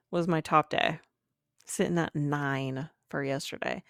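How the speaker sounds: ordinary talk, nothing marked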